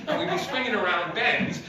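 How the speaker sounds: ordinary talk, nothing marked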